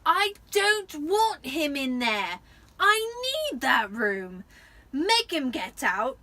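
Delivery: slow, high, loud spoiled howl; a spoiled boy yelling at his mother; bawling. A narrator's reading. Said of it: "Dudley bawling at his mother".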